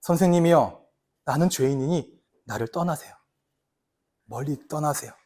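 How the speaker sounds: very soft, low, trembling-humble plea. Retold or imitated. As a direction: kneel and beg him quietly to go. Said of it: "무릎을 꿇고 말합니다". (무릎 꿇은 베드로가 예수께 드리는 아주 낮고 조용한 애원)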